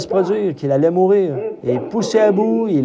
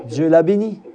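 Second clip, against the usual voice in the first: ordinary delivery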